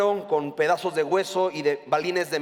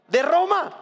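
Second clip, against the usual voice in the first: "Roma" pitched much higher in disbelief; incredulous emphasis; 10 semitones higher, 3 dB louder than usual. Real, not acted. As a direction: land "De Roma" high and loud, incredulous. Mocking the crowd's expectation. (con incredulidad, mucho más agudo)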